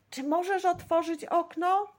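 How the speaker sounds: high, loud call up to a window. A narrator's reading. Boy in a tree calls up to Dorota, loudly. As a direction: call across to the girl behind the glass. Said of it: "zawołał Staszek".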